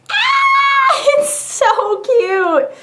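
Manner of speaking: very high squeal, words drawn out; excited squeal. Real, not acted. Showing her new phone case to viewers, excited squeal. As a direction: squeal with delight, stretching the words slowly.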